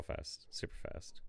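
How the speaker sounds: whispered. Real, not acted. Muttered aside while working.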